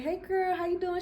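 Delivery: high, sing-song hello to a new friend; bubbly girly greeting. Retold or imitated. Greeting her online friend in a high, bubbly voice. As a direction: chirp it sweetly up high. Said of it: "and I was like".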